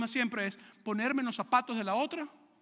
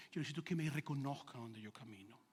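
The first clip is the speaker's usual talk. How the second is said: voz más grave y baja, de orgullo; un toque de pastor engreído; orgullo del pastor; 9 semitones lower, 12 dB quieter than usual. Retold or imitated. Imitando su propio orgullo. (pastor orgulloso, grave y bajo)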